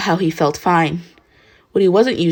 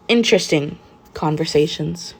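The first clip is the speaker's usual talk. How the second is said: usual speaking voice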